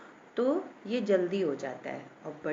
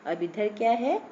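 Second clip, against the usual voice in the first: normal talking voice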